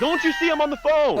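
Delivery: high, loud exasperated protest; angry retort. Retold or imitated. Handler re-enacting scolding someone during a phone call, angry. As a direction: re-enact blowing up at an interrupter. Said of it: "I was so angry".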